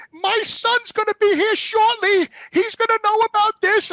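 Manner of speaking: put-on high, slow, haughty old lady; old roommate threatening him, in a high slow old lady voice; old lady threat. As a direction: warn him like a prim old woman, drawn out.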